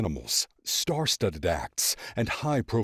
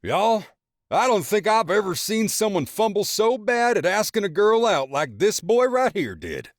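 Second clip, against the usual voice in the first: high, loud, chuckling ribbing of his son; mocking father; 13 semitones higher, 7 dB louder than usual. A narrator's reading. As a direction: roast the boy in front of everyone. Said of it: "chuckling, and says to everyone there".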